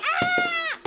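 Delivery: mock dismay. Sees food gone, exclaims.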